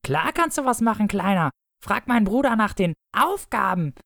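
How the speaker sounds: hoch und verschlagen. Acted. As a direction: locke den Kleinen mit hoher Stimme.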